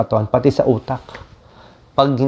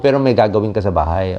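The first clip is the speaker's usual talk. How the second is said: ordinary talk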